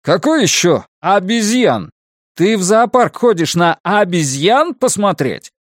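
high-pitched, exasperated scolding; losing composure. A narrator's reading. Chingiz rebukes his friend on the phone, exasperated. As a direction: scold with indignant high peaks. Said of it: "Чингиза вдруг покидает самообладание".

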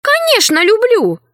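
high, eager exclamation. Acted. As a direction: burst out with eager love. Alice eager reply.

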